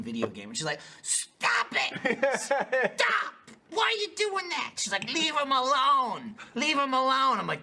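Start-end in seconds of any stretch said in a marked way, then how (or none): 1.0-2.4 high-pitched mock cry of outrage; imitated protest. Partner protesting the game's seal clubbing, mock high cry. 2.4-4.7 very high, drawn-out mock wail; imitated distressed protest. Denise watching seals clubbed, high mock-distressed protest. 5.1-7.4 high, drawn-out mock wail; imitated pleading. Partner defending baby seals, high mock pleading.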